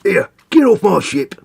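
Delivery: high, slow, loud orc bellow. Acted. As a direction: roar it like an orc warboss throwing you off his ship. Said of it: "my voice isn't deep enough".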